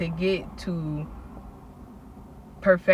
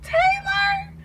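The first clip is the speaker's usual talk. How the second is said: much higher, louder, delighted cry of a friend; excited shout; 8 dB louder, slower than usual. Retold or imitated. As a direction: call out in happy shock. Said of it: "She was like".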